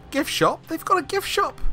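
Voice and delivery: squeaky high delight at a find; excited. Real, not acted. Excited at finding gift shop.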